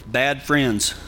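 ordinary talk, nothing marked

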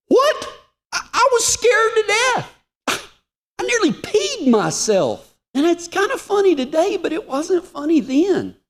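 very high-pitched, mock-terrified voice; comic panicked squeal. Man confessing he was scared to death, hammed-up high squeal.

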